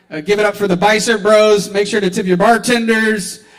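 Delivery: loud, fast host announcement; emcee sign-off. Real, not acted. Host thanking the crowd, loud and fast. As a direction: wrap up the night for the room.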